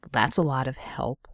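dry, low sarcastic remark. A narrator's reading. Man dismissing a colleague's helpers, dry and sarcastic. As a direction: undercut the offer with dry sarcasm. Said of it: "he said sarcastically".

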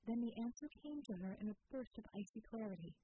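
ordinary delivery, nothing marked

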